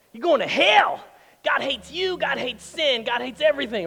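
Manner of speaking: hellfire preacher. Retold or imitated. Mocking harsh churches, a hammed-up screaming preacher. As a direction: bellow it like a judgmental street preacher. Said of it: "and it's like".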